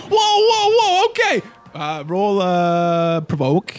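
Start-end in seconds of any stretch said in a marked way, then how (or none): 0.0-1.4 much higher, louder panicked whoa; startled backpedal. Acted. The tail guy panics as a gun hits his chin, high loud whoas. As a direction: throw your hands up in fright.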